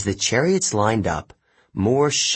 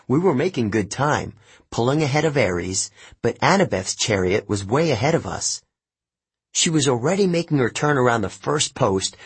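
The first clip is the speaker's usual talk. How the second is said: plain reading voice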